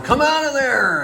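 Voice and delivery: high, loud call down from above; retold yell. Police calling down into the gully, yelling. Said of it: "yelling down".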